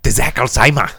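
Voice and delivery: German accent. Shown as a demonstration. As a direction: bark De Zack Alzheimer like a mock German. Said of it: "You got a problem with German?".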